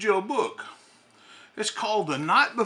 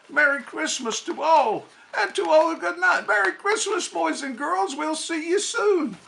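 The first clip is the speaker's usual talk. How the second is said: higher, louder merry call; jolly Santa farewell; 7 semitones higher, 3 dB louder than usual. Acted. Santa calling goodbye to the kids, loud and merry.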